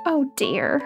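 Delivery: sad sigh. Acted. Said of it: "she started to cry again".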